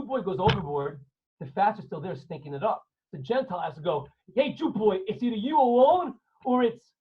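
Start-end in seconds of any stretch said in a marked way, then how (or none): 4.4-6.9 bully him; menacing sailor. Acted. Gentile threat again.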